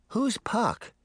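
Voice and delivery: put-on honest puzzled little girl's question. A narrator's reading. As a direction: ask it simply, genuinely confused. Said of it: "Daphne asked".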